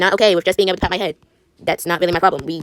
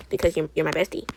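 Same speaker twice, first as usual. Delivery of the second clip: usual speaking voice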